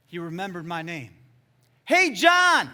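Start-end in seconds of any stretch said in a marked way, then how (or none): 1.8-2.7 high, full-voiced re-enacted yell; yelled call. Boy on the rock calling to the preacher, yelling. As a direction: yell down from a height to someone far below. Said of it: "He yelled it".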